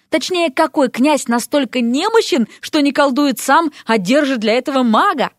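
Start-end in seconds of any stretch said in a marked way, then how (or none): none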